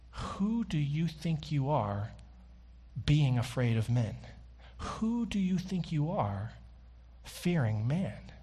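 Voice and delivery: soft challenge. Real, not acted. Preacher gently asking the congregation, quiet and slow. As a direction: turn it inward, quietly.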